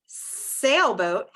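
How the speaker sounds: "Sailboat" slowly with a long S; emphasized S sound. Shown as a demonstration. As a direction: stretch the S starting "Sailboat". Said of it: "has that s sound".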